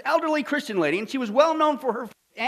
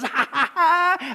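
slow, higher taunting ha ha ha; mocking laugh; 6 semitones higher, much slower than usual. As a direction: laugh at her from the bush. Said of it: "he goes".

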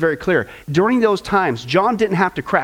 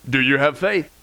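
low, measured heavenly questioner; voice from above; 5 semitones lower than usual. Retold or imitated. Voice from above tests the tourist, acted calm. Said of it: "the voice says".

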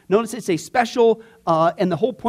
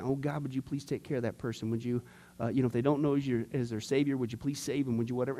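murmured prayer; 6 semitones lower, 12 dB quieter than usual. Retold or imitated. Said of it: "and it's like".

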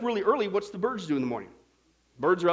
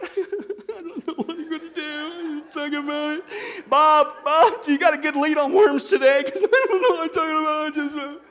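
lightly voiced worried birds, higher and loud; a hint of frantic bird chatter; panicky birds; 8 semitones higher, 7 dB louder than usual. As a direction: play fretting birds gossiping about worms. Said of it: "they're out there going".

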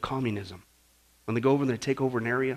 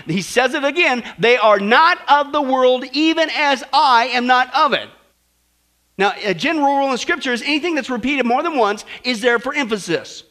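loud sermon building higher and louder; impassioned preaching; 8 semitones higher, 9 dB louder than usual. Acted. Preacher tells church they are not of this world, impassioned preaching. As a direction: drive each phrase home to the congregation.

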